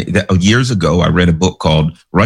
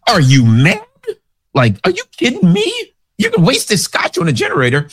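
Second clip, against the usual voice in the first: outraged at wasting good scotch; incredulous outburst; 11 semitones higher than usual. Retold or imitated. Stranded man balks at pouring scotch in the generator, incredulous. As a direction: pitch jumps way up in disbelief. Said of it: "he's like".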